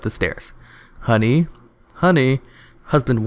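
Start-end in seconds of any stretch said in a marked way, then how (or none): 1.0-1.5 calling up the stairs, questioning; a hesitant rising call of Honey?. Acted. Husband calls his wife upstairs, hesitant rising call. 1.9-2.4 Honey? called higher, more urgent; a sharper second call that falls off; calling louder. Acted. Husband calls again for his wife, higher and more urgent.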